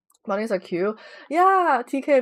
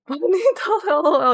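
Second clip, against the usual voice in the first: laughing; 6 semitones higher, 3 dB louder than usual. Real, not acted. Laughing greeting.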